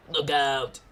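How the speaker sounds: shouted alert in character; acted warning shout. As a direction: call out a sudden warning.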